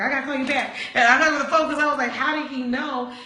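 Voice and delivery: panicked disbelief spilling out; flustered. Retold or imitated. Hanging up on Daryl, shocked and rushed. Daryl guesses she is upset.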